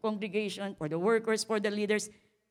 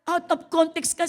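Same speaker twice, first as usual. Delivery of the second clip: louder, higher "Out of context ka" with stress on "ka"; rebuke; 9 semitones higher, 8 dB louder than usual. Acted. Pastor scolding the sister, louder and higher. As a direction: hit "ka" hard. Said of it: "repeated rebuke".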